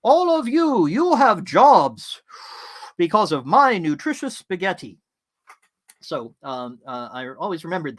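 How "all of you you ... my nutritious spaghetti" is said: high, loud, slow proclamation; booming, drawn-out toast to the staff; grand quoted speech. Retold or imitated. Owner tells staff their jobs come from his spaghetti, in a high, loud, slow grand voice. Quoting a proud spaghetti cook.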